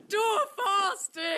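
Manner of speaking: high, loud, drawn-out anguished cry; despairing cry. Acted. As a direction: wail the sword's name in despair. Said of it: "he cried out in despair".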